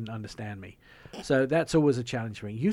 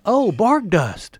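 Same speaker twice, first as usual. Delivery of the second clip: high, loud, drawn-out mimicked "oh"; mock realisation; 8 semitones higher, 9 dB louder, much slower than usual. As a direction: imitate someone suddenly getting it, exaggerated. Operator finally understands, acted.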